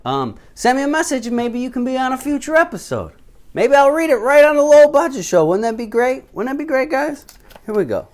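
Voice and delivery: upbeat, high and loud TV host voice; show host voice. Acted. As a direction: sell it like a game show host. Hosts the viewer mail segment.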